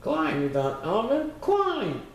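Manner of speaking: high, shrill-pitched yell rising on the last climb; a tiny tyrant shrieking orders from the trunk; yelling bug. A narrator's reading. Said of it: "kept yelling".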